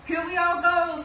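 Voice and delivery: loud, high cheerful cry; jubilant send-off. Acted. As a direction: lead the parade off proudly. Pongo starts the parade.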